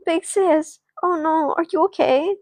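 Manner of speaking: high-pitched acted concern; high, anxious young girl voice; worried little sister. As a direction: check on a hurt sister in alarm. Sister worried after big sister is slapped.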